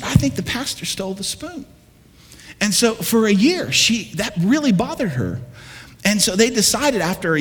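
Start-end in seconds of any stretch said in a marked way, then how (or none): 0.0-1.7 insistent wife, higher, rising on think; insisting. Retold or imitated. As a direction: push the accusation with a rising "think". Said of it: "No, she said".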